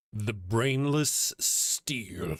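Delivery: slow, savoring reading of the name; drawn-out name. Real, not acted. Host greets a new chatter, slowly savoring the name. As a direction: stretch it out like a grand title.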